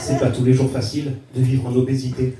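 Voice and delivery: low, measured mock slam recital; slam poetry. Comedian slamming about obesity to the audience, low mock-solemn recital. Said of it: "Je vais vous faire un slam".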